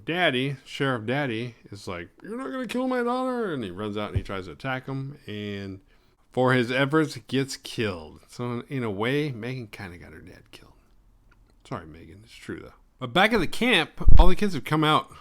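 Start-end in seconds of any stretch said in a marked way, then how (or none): 2.2-3.5 high, held mock heroic cry; defiant father protecting his girl; hammed-up father, much higher; protective dad. Sheriff defending daughter. 9.3-12.7 hushed teasing aside; whispered aside. Real, not acted. Guilty aside.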